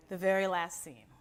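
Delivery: ordinary delivery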